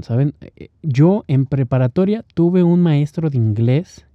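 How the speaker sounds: usual speaking voice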